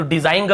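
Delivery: "डिजाइन ग" with the G sounded at the end; wrong pronunciation. Shown as a demonstration. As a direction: tack a hard "guh" onto "डिजाइन". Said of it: "ऐसे ग को नहीं पढ़ रहे".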